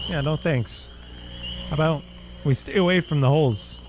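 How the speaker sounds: ordinary talk, nothing marked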